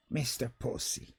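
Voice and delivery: quiet savouring of the name; hushed aside. Pretend. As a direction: drop to a murmured aside. Repeating the name.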